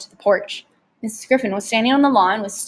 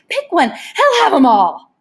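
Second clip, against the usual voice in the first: raised, eager salesman voice, slow on the close; tempting showman; 5 semitones higher, 6 dB louder, slower than usual. Acted. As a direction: dangle the offer like a carnival barker. Rictus tempting Harvey with puppies.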